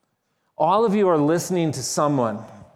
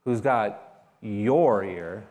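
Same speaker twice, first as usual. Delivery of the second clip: pause, then "your" drawn out; stress on your; 4 semitones lower, slower than usual. Shown as a demonstration. Preacher questions the congregation word by word, stress on your. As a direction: set "your" apart after a short break. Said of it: "A different emphasis on different words".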